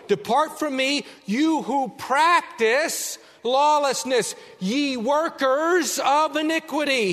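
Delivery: high, loud, slow proclamation; solemn thunder. Acted. Jesus banishing evildoers, loud solemn decree. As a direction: declare the judgment with full force.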